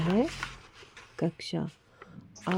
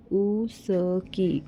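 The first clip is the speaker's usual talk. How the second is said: उसकी said very slowly, syllable by syllable; teacher sounding out उसकी for children; much slower than usual. Shown as a demonstration. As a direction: draw out उसकी so each sound is clear. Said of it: "जैसे कि ये".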